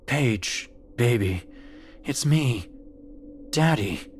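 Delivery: a hint of a doting daddy; loving father's voice. A narrator's reading. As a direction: coax your child as a loving father. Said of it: "It was her father's. Male, baritone, full of the love".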